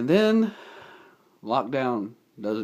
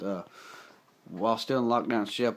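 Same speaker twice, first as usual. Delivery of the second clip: ordinary talk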